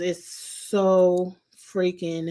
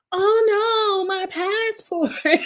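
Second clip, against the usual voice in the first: high, drawn-out wail of dismay; mock panic; 11 semitones higher, much slower than usual. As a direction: cry out in exaggerated alarm, stretching the end. Retold panic at dropped passport.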